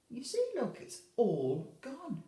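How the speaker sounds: usual speaking voice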